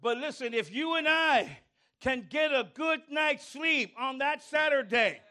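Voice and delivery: usual speaking voice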